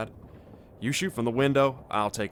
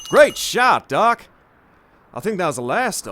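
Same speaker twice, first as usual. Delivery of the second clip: high, loud cheer, then fast; triumphant shout; 7 semitones higher, 10 dB louder than usual. Acted. Quincy praises Doc's shot after the fight, excited shout. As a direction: cheer your friend's hit, still pumped.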